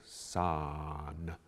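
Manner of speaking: san, the final N hummed and voiced on the rest; vocalized N ending. Shown as a demonstration. Choir director shows the word son with a sung N ending. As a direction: sing san with a held voiced N. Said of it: "place a vocalized N".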